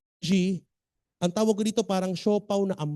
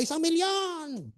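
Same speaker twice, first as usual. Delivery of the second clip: mock hype cheer; 9 semitones higher, 6 dB quieter, slower than usual. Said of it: "May, may palakpakan pa".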